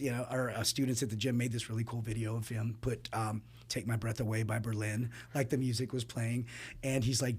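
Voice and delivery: normal talking voice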